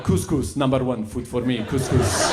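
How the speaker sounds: put-on halting, foreign-accented broken English. Nephew praising the food to family, put-on foreign accent. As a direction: lay on a mock foreign accent. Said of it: "ethnic it up every time".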